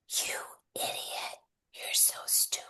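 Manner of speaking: the inner critic as a far-off breathy whisper. Shown as a demonstration. Guide voices the inner critic turned down, hushed whisper. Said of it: "what happens if you turn it softer".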